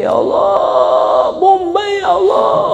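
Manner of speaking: high, strained fake crying shout; onion-induced mock wail. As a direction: bawl as if onion is in your eyes. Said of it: "kasih bawang bombay". (pura-pura meraung kena bawang)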